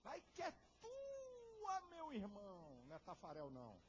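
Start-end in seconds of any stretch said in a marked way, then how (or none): 0.0-2.8 high, slow stretched football cry; cheering outside voice. Retold or imitated. Imitating outside voices egging him on, stretched cheer. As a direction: cheer like a commentator. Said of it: "ajuda de vozes externas".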